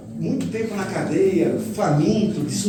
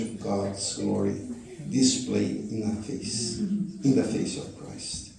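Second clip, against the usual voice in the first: slow, softer reading of the verse in English; slow scripture reading; 6 semitones lower, 4 dB quieter, slower than usual. Acted. Preacher reads the verse aloud to the church, slow and soft. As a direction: let each phrase of the verse land slowly.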